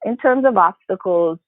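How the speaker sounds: ordinary talk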